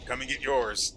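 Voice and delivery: spring the trap and jeer at the foe; a playful shouted taunt at the enemy; mock ambush taunt.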